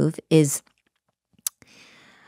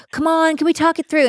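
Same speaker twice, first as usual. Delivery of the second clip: high pleading call, chasing after him; frantic plea; 11 semitones higher than usual. Retold or imitated. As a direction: beg him to stop and talk, voice up high. Chasing him through the hotel.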